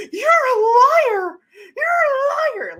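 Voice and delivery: very high, loud, drawn-out shrieking yell; Kermit-loud screech. Retold or imitated. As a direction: screech it shrilly, stretching the words, mocking bad screaming. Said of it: "just Kermit loud, screeching throughout".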